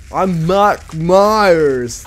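milk every vowel in a bad Scottish accent; hammed-up Mike Myers. Gamer overdoing Shrek actor impression, drawn-out Scottish accent. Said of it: "That's not accurate either".

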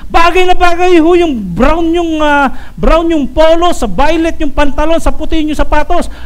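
high-pitched piled-on compliments; gushing flattery. Acted. Employee praising the boss's outfit, sucking up. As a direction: flatter the boss item by item, hammed-up.

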